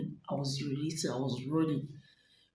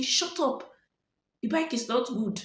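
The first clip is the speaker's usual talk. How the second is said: much higher, louder barked command imitating the officer; retold angry shout; 8 semitones higher, 4 dB louder than usual. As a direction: snap at him like a rough officer. Officer's reply, retold quarrel.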